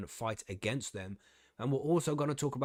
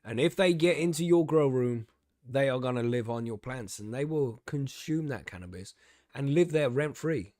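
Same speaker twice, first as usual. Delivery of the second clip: raised, emphatic public warning; rally everyone against the invaders; mock rousing speech; 2 semitones higher, 4 dB louder than usual. Host warning growers about freeloading bugs, mock rousing speech.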